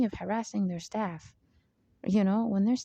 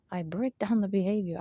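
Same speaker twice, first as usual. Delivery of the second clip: ordinary talk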